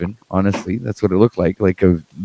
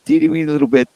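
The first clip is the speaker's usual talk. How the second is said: cutesy downplaying; faster than usual. Playing down the mushroom dose.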